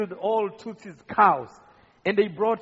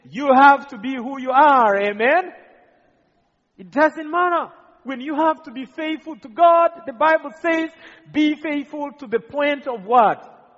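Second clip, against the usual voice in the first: higher, louder preaching build; a preacher's joyful climax; fiery preaching; 5 semitones higher, 6 dB louder than usual. Acted. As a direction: lift the crowd with rising fervour. Preaching at full force.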